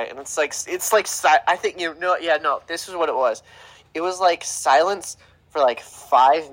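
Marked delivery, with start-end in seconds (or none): none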